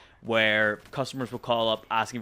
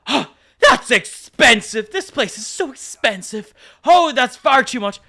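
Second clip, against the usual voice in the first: much higher, slow whining complaint; hammed-up moaning shopper; whiny mock customer; 12 semitones higher, 10 dB louder, slower than usual. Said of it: "And they're like".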